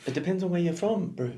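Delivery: put-on high jokey Kiwi accent; hammed-up New Zealand accent. Keeps the joke accent going.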